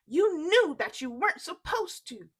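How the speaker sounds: mother scolds Jack, angry and loud. Acted. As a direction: scold him sharply. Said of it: "said his mother".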